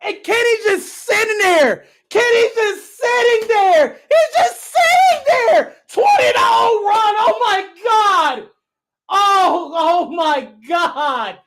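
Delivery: high, slow crying yell; near-sobbing fan lament; anguished wail. Real, not acted. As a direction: despair over the run. Anguished at losing run.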